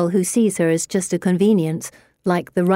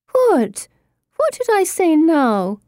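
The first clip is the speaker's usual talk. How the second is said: much higher, whining protest; whiny moan; 10 semitones higher, 3 dB louder, slower than usual. Acted. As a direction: moan it innocently. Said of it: "Pippa moans".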